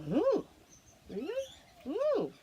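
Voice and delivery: very high squeals of surprise; delighted ooh. Acted. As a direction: gasp with delight. Emu reacts delighted.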